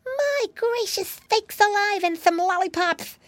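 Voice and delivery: put-on very high piggy-boy astonishment; amazed exclamation. A narrator's reading. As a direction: exclaim like a little pig amazed at treats. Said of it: "exclaimed Flop".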